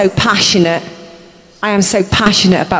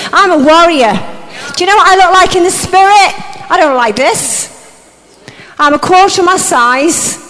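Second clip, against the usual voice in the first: fiery preaching; 5 semitones higher than usual. Acted. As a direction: stir the crowd to its feet. Preaches at full force.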